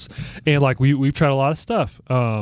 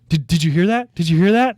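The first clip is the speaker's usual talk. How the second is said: higher, louder, gasping "did you hear that"; mock surprise; 6 semitones higher, 4 dB louder than usual. Dad pretends the lions are running, mock startled. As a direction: sell the fake alarm to a child. Dad fakes hearing animals flee.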